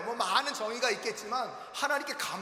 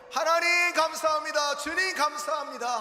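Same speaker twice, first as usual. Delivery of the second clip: higher, louder cry of thanks; 7 semitones higher, 5 dB louder than usual. Acted. Healed leper crying thanks to God, loud. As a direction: call out your thanks to God. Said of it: "아, 큰 소리로".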